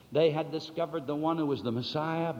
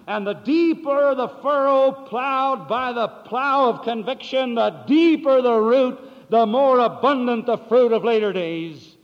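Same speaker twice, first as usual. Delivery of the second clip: preaching at full force; 8 semitones higher, 11 dB louder than usual. Acted. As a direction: drive the image home like a fiery preacher.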